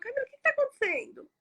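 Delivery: high, quick, worried friend asking; concerned driver. Retold or imitated. Driver finding her crying in the street, worried voice. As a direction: voice the driver's caring question. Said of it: "ele pegou e falou".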